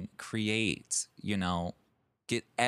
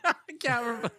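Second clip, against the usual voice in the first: laughing; 12 semitones higher, much faster than usual. Real, not acted. Laughing while talking.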